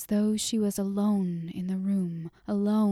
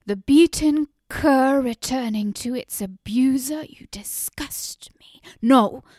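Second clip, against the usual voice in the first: louder, higher scorn at her husband; furious cry; 3 semitones higher, 9 dB louder than usual. A narrator's reading. Wife turning on her husband in disgust, furious. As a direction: spit the contempt at him. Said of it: "Nissa turned on him and cried".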